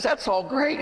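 high, encouraging pastor voice; imitated pastor. Pastor to the sobbing mother, high and encouraging. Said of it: "And the pastor says".

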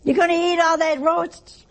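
high-pitched dinner-table challenge; Italian family talk. Retold or imitated. Relative at an Italian family dinner, mock challenge. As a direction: pitch it up, imitating family bickering. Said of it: "They're not yelling yet".

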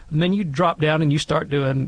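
ordinary talk

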